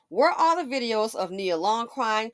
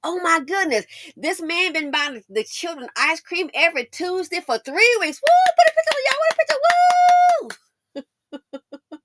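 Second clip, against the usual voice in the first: high, loud hammed-up hollering; mock cheering crowd; 9 semitones higher, 8 dB louder than usual. Mocking people who praise men for the bare minimum, shrieking high and loud. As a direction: whoop it up like an overexcited fan, Woo. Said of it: "That's why I've been one dusting".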